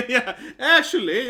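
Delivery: slow, loud laugh through the words. Real, not acted. Narrator laughing at being the know-it-all kid, real laugh. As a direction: chuckle out the admission.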